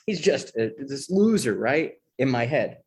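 ordinary delivery

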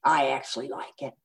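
low-voiced affectionate grown son; low, easy mock-son voice; Sam. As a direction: imitate a son reassuring his mother. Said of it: "Sam laughed and he goes".

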